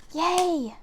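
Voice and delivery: ordinary delivery, nothing marked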